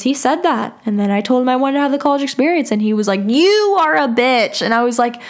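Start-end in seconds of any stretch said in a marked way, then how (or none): 3.3-4.6 slow, exaggerated imitation of an insult; mock insult. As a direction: stretch it out, mocking his outburst. Mimics boyfriend's insult in argument.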